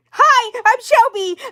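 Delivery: very high, loud, piercing introduction; annoying niece blaring hello; Shelby. Shown as a demonstration. Doll introducing herself, loud annoying voice. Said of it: "Make her have a loud, loud voice".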